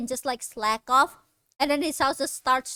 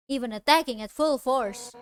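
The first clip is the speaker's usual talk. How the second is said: ordinary talk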